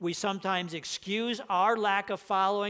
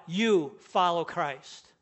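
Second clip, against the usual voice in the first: you follow Christ said slow and louder; stress on you; 4 dB louder, much slower than usual. Shown as a demonstration. Preacher urging the congregation, slow stress on you. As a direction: press on you, then slow down. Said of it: "emphatic you theme".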